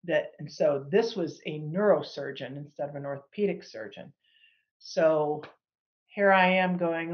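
ordinary talk

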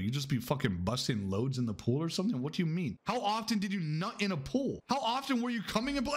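normal talking voice

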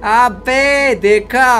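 slow, high, loud crowing; triumphant. Real, not acted. Gamer showing off a cracked puzzle, drawn-out triumph. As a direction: crow it, stretching each word.

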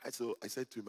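ordinary talk, nothing marked

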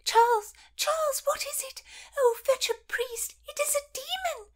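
put-on high, breathless whispered shriek of a frightened old lady; hammed-up high panicked old woman. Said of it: "Lady Paget shrieked".

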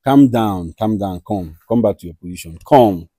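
soft, low hushed calming; soothing calm down. Real, not acted. Host hushing a guest mid-quarrel, quiet and soothing. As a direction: murmur to settle him.

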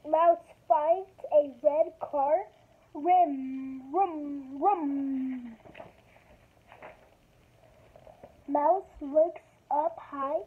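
slow, careful picture-book reading; reading to children. Acted. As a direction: read each page slowly to kids. Reads picture book aloud.

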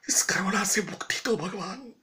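unvoiced, pleading whisper; whispered prayer. Real, not acted. Praying to God for rescue, whispering. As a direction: beg God under your breath.

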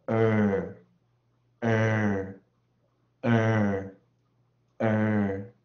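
呃 held long, loud and level, repeated with gaps; vowel sound demonstrated. Shown as a demonstration. Teacher drilling the vowel sound for students, 呃 as long loud "er". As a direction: sustain each 呃 as a steady r-coloured "er". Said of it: "如果发音为呃".

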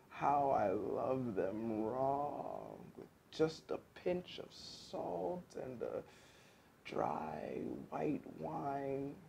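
very slow, soft, trailing into whisper; dreamy mumble. A narrator's reading. Gassed fox dreaming of a tasty meal, dreamy mumble. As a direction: savour each word drowsily, ever breathier. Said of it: "he mumbled".

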